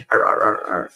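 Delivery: low growly dog noises; little dog growling. Retold or imitated. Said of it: "I was like a little dog".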